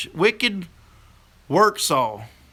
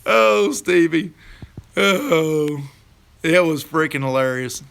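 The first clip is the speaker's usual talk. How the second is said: ordinary delivery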